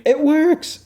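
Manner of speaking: shrieked way up high in triumph; a thrilled squeal of success; excited scream. Retold or imitated. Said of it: "She's screaming".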